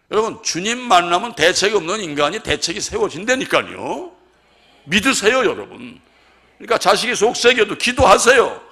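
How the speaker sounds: loud, high pulpit exhortation; fervent preaching. Acted. Pastor urging the congregation, fervent preaching. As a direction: preach it out at full force.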